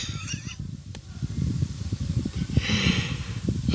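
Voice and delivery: long, near-silent wheeze of laughter; real breathy giggling fit; stifled wheezing laugh. Real, not acted. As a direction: lose it quietly, out of breath. Cracks up at own mistake.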